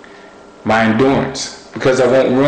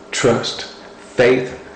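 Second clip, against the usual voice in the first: usual speaking voice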